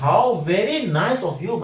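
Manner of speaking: slow, leaning on HOW VERY; emphatic how very. Shown as a demonstration. Teacher shows students the emphatic compliment, stressed. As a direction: draw out "How very nice" with emphasis. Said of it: "इसी चीज पे थोड़ा जोर देकर बोलना हो".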